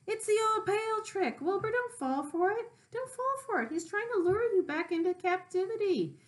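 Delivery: very high goose voice warning; a goose telling the pig not to fall for it. Acted. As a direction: honk the warning urgently. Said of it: "said the goose".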